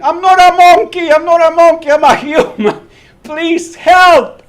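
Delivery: high, loud terrified yelling; panicked scream. Retold or imitated. Man in monkey suit screaming at lion, panicked yell. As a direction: scream for your life at the lion. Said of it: "And I started screaming".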